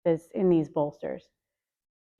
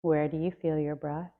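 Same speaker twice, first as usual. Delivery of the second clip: guided meditation. Acted. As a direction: ease them inward, gently and unhurried. Leads a breath meditation.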